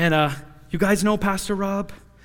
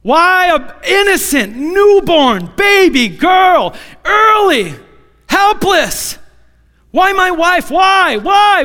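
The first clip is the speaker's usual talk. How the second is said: desperate shout rising high, pleading Why over and over; yelling at God; 10 semitones higher, 7 dB louder, slower than usual. Retold or imitated. Father in the car yelling at God about his sick baby. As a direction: cry out to God in anguish, loud and high. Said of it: "I just started yelling out".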